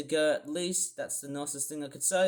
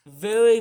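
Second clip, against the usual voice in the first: "very" punched high and very loud; emphatic disgust; 6 semitones higher, 14 dB louder than usual. Real, not acted. Ropeable at the forecast, loud emphatic very. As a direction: hit "very" hard in disgust. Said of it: "makes me feel ropeable".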